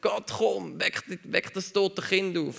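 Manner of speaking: high, desperate plea to God; pleading cry to God. Retold or imitated. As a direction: cry out to God like a begging prophet. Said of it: "indem er zu Gott geschrien hat". (hoch und flehend zu Gott rufen)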